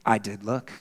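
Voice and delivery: low, soft simple admission; a hint of young Spurgeon, soft; quiet confession. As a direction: say it quietly, humbly. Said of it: "And Spurgeon says".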